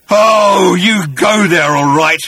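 slow, loud, anguished wail; broken voice. Acted. Agrajag telling Arthur of his future visit, broken cry. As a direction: draw it out high, as if broken. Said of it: "muttered Agrajag in a broken voice".

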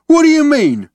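loud, high, uncomprehending brute; booming. A narrator's reading. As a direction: boom the question out. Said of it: "he boomed".

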